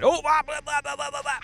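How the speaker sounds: mocking blah blah. Real, not acted. Mock babble at enemy.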